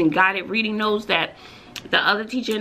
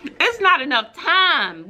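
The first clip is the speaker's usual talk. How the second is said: exasperated; 9 semitones higher, slower than usual. Real, not acted. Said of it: "panicked about time".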